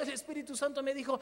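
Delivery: ordinary delivery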